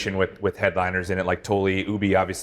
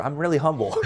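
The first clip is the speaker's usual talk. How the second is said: higher, breaking into a laugh; joking, amused; 7 semitones higher than usual. Real, not acted. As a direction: toss it off as a joke, laughing. Jokes about his unhumble comment, laughs.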